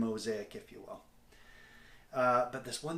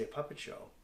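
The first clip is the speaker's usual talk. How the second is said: sheepish answer; 2 semitones higher, 7 dB quieter than usual. Retold or imitated. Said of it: "And I say".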